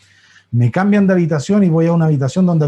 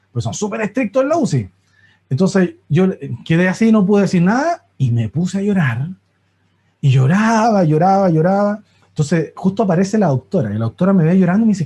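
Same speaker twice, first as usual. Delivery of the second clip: ordinary talk, nothing marked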